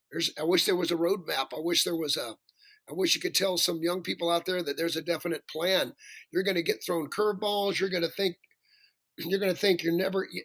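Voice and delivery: normal talking voice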